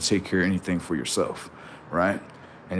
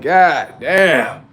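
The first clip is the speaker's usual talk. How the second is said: drawn-out exclaim; 6 semitones higher, 8 dB louder than usual. Real, not acted. Reacting to the boy's words.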